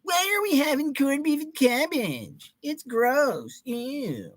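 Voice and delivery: put-on high whining child voice with a disgusted ew; whiny kid. Streamer imitating his younger self refusing dinner, whiny. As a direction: whine like a picky little kid. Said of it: "That was me as a kid".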